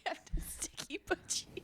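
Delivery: breathy whisper of the silly name. Pretend. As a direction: whisper it like a secret.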